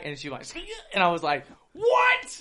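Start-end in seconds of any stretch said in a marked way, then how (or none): 1.7-2.4 high startled yelp of a question; shocked what. Retold or imitated. Storyteller retells being pinned, startled high yelp. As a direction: caught totally off guard. Surprised during sex.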